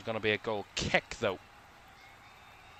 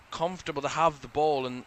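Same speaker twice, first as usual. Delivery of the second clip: ordinary talk, nothing marked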